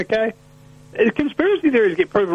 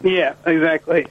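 ordinary talk

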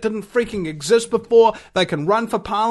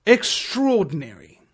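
normal talking voice

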